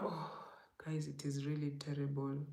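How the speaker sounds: hushed horror; horrified whisper. Real, not acted. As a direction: whisper it as if too awful to say. Horror at dream memory.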